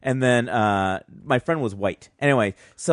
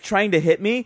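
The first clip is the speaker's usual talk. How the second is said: ordinary delivery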